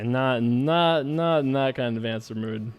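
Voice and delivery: ordinary delivery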